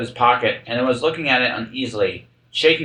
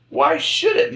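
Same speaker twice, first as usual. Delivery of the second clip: high querulous mutter; high peevish retort; slower than usual. A narrator's reading. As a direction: grumble it back in a high peevish voice. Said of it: "muttered the Hatter".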